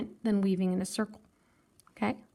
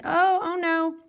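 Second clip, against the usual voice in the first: high, louder playful oh no; hammed-up surprise; mock dismay; 7 semitones higher, 6 dB louder, slower than usual. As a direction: pretend alarm for the class. Teacher runs out of wire.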